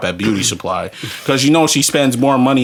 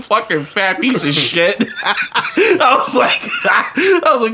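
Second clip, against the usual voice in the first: laughing; 9 semitones higher, slower than usual. Real, not acted. Still laughing at fat guy.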